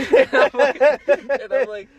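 high, loud laughing speech. Real, not acted. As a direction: laugh through the words. Laughing while telling.

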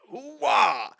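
imitating Vader's strike yell; mock battle cry. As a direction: swing the saber with a silly war cry. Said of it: "He goes".

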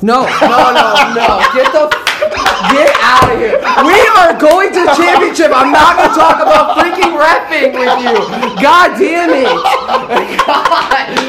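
high, loud, laughing disbelief; overjoyed, laughing shouts; laughing excitedly. Real, not acted. Thrilled at making the championship.

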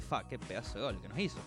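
quiet, grudging awe; resigned mutter. Retold or imitated. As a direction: mutter it like a beaten fan. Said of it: "no podés hacer otra cosa que decir".

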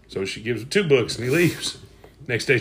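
usual speaking voice

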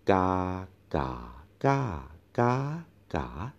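ga said in each tone in turn; five Thai tones. Shown as a demonstration. Teacher models homework, ga through all tones. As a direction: run ga through every tone, one by one. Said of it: "practice all the tones by order".

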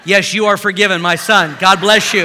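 loud, solemn pretend absolution; hammed-up priest blessing; mock priestly blessing. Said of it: "No, I'm kidding".

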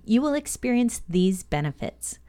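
ordinary talk, nothing marked

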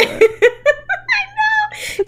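high laugh through words. Real, not acted. Laughing at the explanation.

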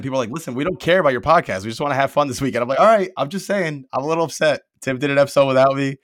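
usual speaking voice

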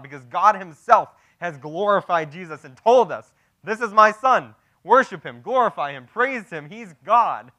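loud, punchy preaching at full force; emphatic preacher's climax, pitch lifted; impassioned preaching. Acted. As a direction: drive each short phrase home from the pulpit.

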